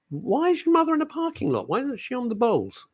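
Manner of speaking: mock-baffled high squeak; high disbelieving squeak; incredulous. Real, not acted. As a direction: rise up in comic disbelief. Incredulous radio host.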